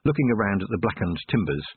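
plain reading voice

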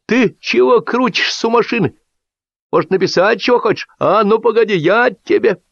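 high-pitched threatening yell; acted high, threatening call; shouted scolding. A narrator's reading. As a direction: scold a kid from the window. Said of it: "закричал в окно Лисипедов".

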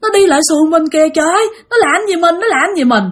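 high, frightened acted voice; high-pitched alarmed plea to a companion; panicked cry. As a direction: call out in rising panic, words tumbling. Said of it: "Thì sợ quá".

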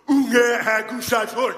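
slow, loud, stern booming call; a stern old actor summoning the boy; Ernst-Hugo voice. Retold or imitated. Comedian imitating Ernst-Hugo, stern slow booming call. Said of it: "Ernst-Hugos röst som säger".

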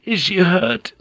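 high frightened question, lifting at the end; a mild narrated shriek of worry; alarmed cry. A narrator's reading. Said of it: "Kiri shrieked".

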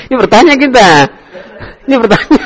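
high-pitched words breaking into laughter; helpless, amused laughing correction. Real, not acted. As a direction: correct them while cracking up. Teacher laughing while correcting student's intonation.

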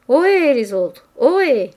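loud, high hailing call. A narrator's reading. Diner hailing the others across the room, calling out. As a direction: holler to the others, long swooping vowels.

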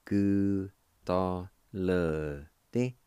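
"Gu ta le di" very slow, every syllable drawn out; syllable-by-syllable pronunciation. Shown as a demonstration. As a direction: sound out "Gu ta le di" slowly for a learner. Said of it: "it's pronounced like this".

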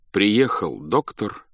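ordinary delivery

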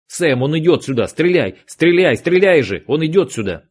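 yelling a frantic warning; urgent shout. Acted. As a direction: shout it out to your partner. Agent yelling warning in gunfight.

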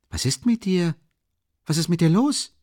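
worried friend. Acted. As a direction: ask in alarm about your friend. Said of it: "fragte Rasumichin besorgt".

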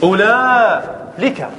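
"أولالك" very slow, ulaaa, then lika; no hamza. Shown as a demonstration. Teacher demonstrating the Tamimi form, slow and split. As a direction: stretch "أولالك" and split it before the l, no glottal stop. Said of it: "لا يوجد مد".

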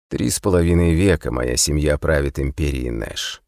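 usual speaking voice

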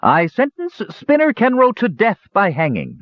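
high, ringing royal proclamation; queen proclaiming his hanging; proclaiming sentence. A narrator's reading. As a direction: announce the death sentence to the court. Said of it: "she proclaimed".

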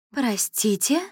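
amazed question. A narrator's reading. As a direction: blurt it out in disbelief. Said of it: "изумилась Мария Фергин". (изумлённо, выше обычного)

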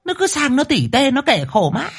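high-pitched, fast flustered wife; flustered old wife. A narrator's reading. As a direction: rattle off excuses, high and hurried. Wife rattles off excuses.

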